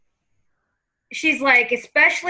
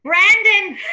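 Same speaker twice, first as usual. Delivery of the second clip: higher, louder New York grandma; New York accent; 5 semitones higher, 5 dB louder than usual. Acted. Medium as grandmother addressing her grandson, New York accent. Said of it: "the way she's doing it".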